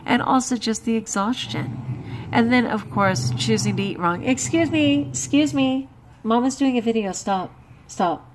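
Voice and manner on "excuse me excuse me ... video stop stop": sharp interruption. Real, not acted. Scolding child during video.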